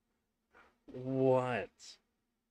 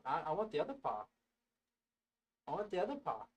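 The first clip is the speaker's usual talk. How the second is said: lightly voiced cat asking for more; cat's voice; 5 semitones higher, 7 dB quieter, faster than usual. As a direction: speak for the cat, a touch higher. Voicing the cat's wish.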